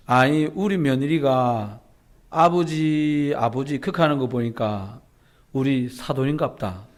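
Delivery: slow, drawn-out light Gyeongsang dialect; a hint of a granny explaining with a slow stretched "아버지"; Gyeongsang grandma. A Gyeongsang grandmother explaining to her friend, slow dialect. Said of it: "먼저 얘기를 꺼냈던 할머니가 하는 말입니다".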